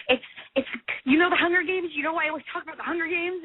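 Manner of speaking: high-pitched, urgent retold explaining; retold frantic excitement. Fan urgently explaining to her best friend, frantic. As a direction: blurt it out, imitating your flustered self.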